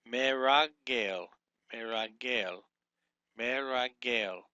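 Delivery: Meragel repeated slowly as me-ra-gel, falling each time; careful pronunciation demo. Shown as a demonstration. Teacher modelling the word for students, slow repeated demo. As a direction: drill Meragel three times, unhurried. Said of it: "is how you would pronunciate that".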